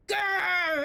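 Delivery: choked angry growl. Real, not acted. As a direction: growl out the frustration through tears. Frustrated growl while crying.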